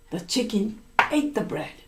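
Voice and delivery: usual speaking voice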